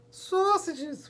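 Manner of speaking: put-on very high-pitched gleeful toddler whoop. A narrator's reading. Little Treasure asked what he wants for dinner, whooping. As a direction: squeal it out in one long whoop. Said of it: "whooped Little Treasure".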